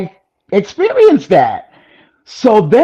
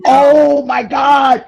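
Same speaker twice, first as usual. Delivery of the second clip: high amazed cry, drawn out; amazed outcry; 8 semitones higher, slower than usual. Real, not acted. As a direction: gasp it out, stunned and thrilled. Hearing friend never went up.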